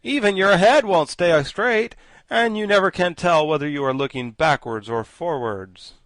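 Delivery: loud, nasty mockery; loud vicious taunt. A narrator's reading. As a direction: jeer viciously. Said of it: "in a vicious manner".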